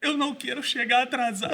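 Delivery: loud, high-pitched crying imitation of wife; exaggerated tearful whine; mock crying. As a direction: wail it like an upset wife, hammed-up. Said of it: "e ela chorando".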